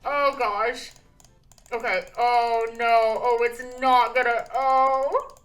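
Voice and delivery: loud, wailing dismay; on the verge of crying. Real, not acted. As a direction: moan it out in open despair. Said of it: "I'll cry".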